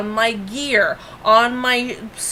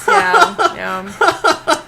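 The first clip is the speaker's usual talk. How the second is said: high laughing yes; laughing yeah; 8 semitones higher, 5 dB louder than usual. Real, not acted. Cracking up at the burger joke, laughing.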